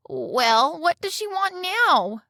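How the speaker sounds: slow, high flounder asking again. Acted. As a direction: draw the words out like a weary magic fish. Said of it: "said the flounder".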